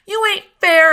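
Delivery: loud, high cry of unfairness; loud protest. A narrator's reading. Scout protests loudly to Uncle Jack. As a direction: fling the protest at him.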